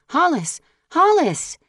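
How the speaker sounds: high desperate calling of a name; crying out. Acted. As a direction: call out after him in panic, high and loud.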